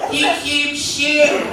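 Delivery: high, loud, slow mock complaint; hammed-up inmate voice; plotting inmate. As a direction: whine it like a disgusted inmate. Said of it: "I can hear 'em plotting".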